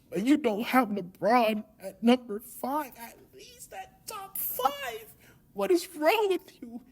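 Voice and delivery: a hammed-up sobbing betrayed host; Shannon Sharpe impression. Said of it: "my terrible Shannon Sharpe impression".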